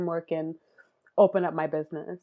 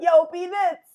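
brother calling out; 10 semitones higher than usual. Retold or imitated. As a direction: shout the nickname like a Brooklyn brother. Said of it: "He's like".

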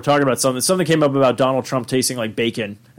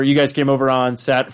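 usual speaking voice

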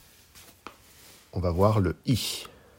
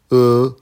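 E held high and perfectly level; first tone on E; 4 semitones higher, 10 dB louder than usual. Shown as a demonstration. Teacher showing learners Mandarin E, high level tone. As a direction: say E loud, long, high and even. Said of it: "premier ton".

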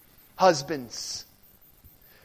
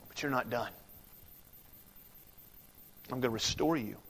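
low, very soft, long pauses; hushed solemnity; 7 semitones lower, 14 dB quieter, faster than usual. Acted. Preacher voices Jesus's words to Peter, hushed. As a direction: speak gently and low, letting each line settle.

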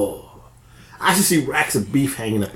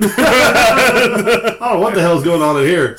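laughing; 7 semitones higher, 6 dB louder, slower than usual. Real, not acted. Laughing exclamation.

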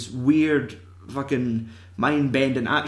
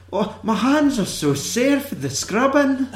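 hammed-up high-pitched bad Scottish accent; mock an actress's poor attempt at Scottish; 10 semitones higher than usual. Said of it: "bad Scottish accents".

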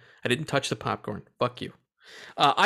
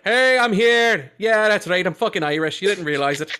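Tom Cruise Irish; 6 semitones higher, 6 dB louder than usual. Retold or imitated. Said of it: "He's like".

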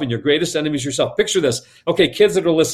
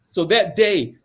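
usual speaking voice

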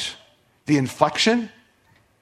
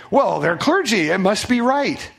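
mocking voice; 6 semitones higher than usual. Mocks the clergy argument.

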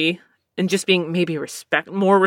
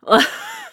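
sharp high-pitched giggle. Real, not acted. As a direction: squeak out a laugh. Laughs after the insult.

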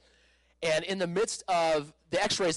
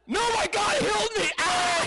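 high, shouted joyful testimony; triumphant shout; 5 dB louder than usual. Retold or imitated. Triumphant reply to doctor.

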